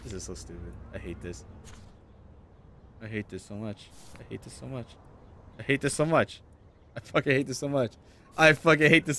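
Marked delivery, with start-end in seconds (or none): 0.0-4.9 muttered frustration. Real, not acted.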